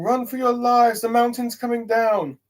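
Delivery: high, loud panicked yelling; panicked shout. Retold or imitated. Crowd fleeing the collapsing mountain, panicked shouting. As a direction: scream the warning as the mountain falls. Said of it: "many people shouting".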